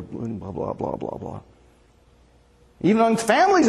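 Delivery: ordinary talk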